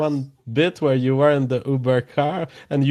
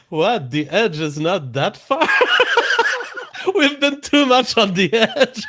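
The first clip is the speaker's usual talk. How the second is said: squeezing the words out between big laughs; can barely get it out for laughing; laughing hard; 9 semitones higher than usual. Real, not acted. Co-host joking about living on the edge, laughing helplessly.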